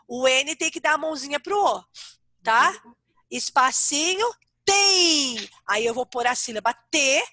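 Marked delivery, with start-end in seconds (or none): 4.6-5.5 "tem" stretched long on the nasal ending; teacher dictating the syllable "tem" slowly; sounded-out syllable. Shown as a demonstration. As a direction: hold TEM high and drawn out. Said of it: "na hora que eu falei ontem".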